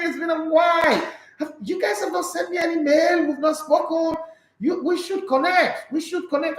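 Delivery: high-pitched, thrilled hello to a long-lost friend; excited greeting. Real, not acted. Host hailing a listener he has missed, high and excited.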